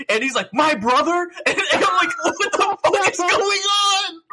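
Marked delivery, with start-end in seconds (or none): 0.5-1.3 high, loud, melodramatic question; mock shock. Snake learning that Liquid is there, mock shock. Acts hero's shock at reveal. 2.2-4.2 very high, slow, drawn-out exasperated cry; baffled outcry. Retold or imitated. Player lost in the convoluted plot, baffled outcry.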